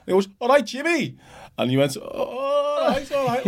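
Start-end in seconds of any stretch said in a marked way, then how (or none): 0.4-1.2 high imitated greeting; high imitating of the old man's greeting; high mock greeting. 2.0-3.5 high, drawn-out imitated greeting; high, very slow imitation of the host's greeting; high slow mock greeting.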